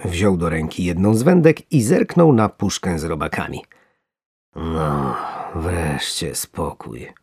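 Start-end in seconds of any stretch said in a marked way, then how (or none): 4.6-7.1 slow, soft, low relief; relieved sigh. Acted. Fisherman sighs, relieved to finally sit down. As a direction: breathe out the calm. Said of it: "odetchnął głęboko".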